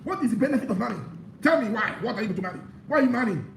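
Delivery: high-pitched, insistent sermon push; fiery preaching. Acted. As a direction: hammer the question at the congregation. Preacher urging singles not to marry.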